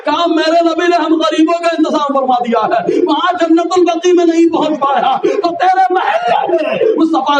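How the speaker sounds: rousing pulpit appeal; preaching. Acted. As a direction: build to a fervent invitation. Fervent climax of sermon.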